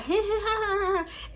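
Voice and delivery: high, put-on giggle; mock laugh. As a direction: fake a high tittering laugh. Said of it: "I'm not".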